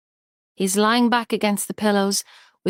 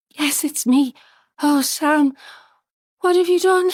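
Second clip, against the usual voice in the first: high, louder anguished plea; distraught; 8 semitones higher, 4 dB louder than usual. Acted. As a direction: near tears in panic. Distraught over overdosed husband.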